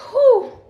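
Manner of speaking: high, loud exhaled whew. Real, not acted. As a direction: let off steam in one high breath. Lets off steam after venting.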